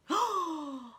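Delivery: big theatrical gasp; horrified gasp. A narrator's reading. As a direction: gasp at a dreadful realisation. Humpy Rumpy realises the plan.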